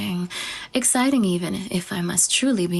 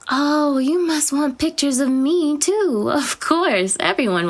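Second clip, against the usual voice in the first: excited; 7 semitones higher, 3 dB louder than usual. Acted. Delighted realization about photos.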